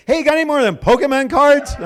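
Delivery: high, loud imitated kid; high-pitched mock eagerness; eager kid. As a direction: pipe up hopefully at the door. Retold eager kid at door.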